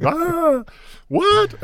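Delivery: high squealed outrage; mock horrified "what"; shocked shriek. Outraged at "die männliche Siri.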